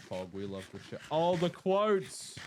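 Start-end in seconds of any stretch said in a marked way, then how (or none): 1.1-2.4 slow, loud drawn-out cheer; a loud, drawn-out cry of delight; excited. Real, not acted. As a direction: stretch it out in delight. Excited about quotes.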